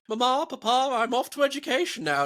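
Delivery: high, mock-genteel send-up; exaggerated prim farewell to Mama and Papa; posh child at home. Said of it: "being at home and going".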